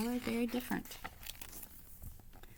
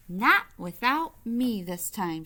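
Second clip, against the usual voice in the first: determined; 3 semitones higher, 9 dB louder, slower than usual. Acted. Helen defiant, hands on hips.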